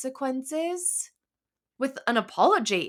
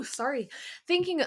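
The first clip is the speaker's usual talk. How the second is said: ordinary delivery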